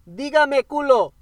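slow, high, loud holler; mock calling out. Pretending to summon hookers in Spanish, slow loud call. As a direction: holler it slowly, drawn out.

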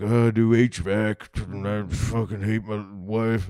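slow, drawn-out put-on voice, starting loud; miserable straight man. Comic imitating a straight person asked about work, mock misery. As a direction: drag it out as a hammed-up fed-up husband. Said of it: "like, a straight person".

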